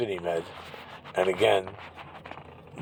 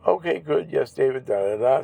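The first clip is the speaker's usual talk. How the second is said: mock doctor voice; 5 semitones higher than usual. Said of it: "I'm with this woman".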